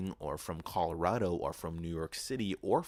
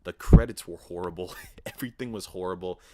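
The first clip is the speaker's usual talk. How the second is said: normal talking voice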